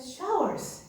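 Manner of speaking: high, emphatic one-word wish; eager wish. Retold or imitated. Nun eagerly naming her wish to the official. Asking for a magic-wand wish.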